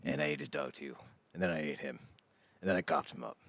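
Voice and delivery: low, quiet deadpan killer; matter-of-fact murmur from a sitcom psycho; Sweet Tooth confession. Acted.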